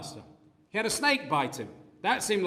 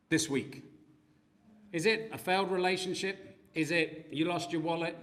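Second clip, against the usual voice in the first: usual speaking voice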